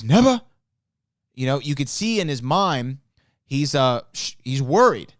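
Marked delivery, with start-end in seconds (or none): 0.0-0.4 higher, louder startled question; a quick incredulous "Never?"; imitating Doc, caught off guard; Doc's surprise. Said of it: "And Doc goes".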